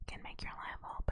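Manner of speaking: hushed aside whisper. Acted. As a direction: whisper it secretly.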